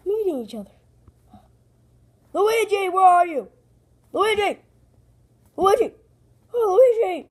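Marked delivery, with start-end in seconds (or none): 2.3-7.3 high, loud calls for someone missing; calling out. Acted. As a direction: shout to find your brother.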